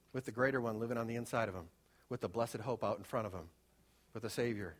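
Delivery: hushed tender. Acted.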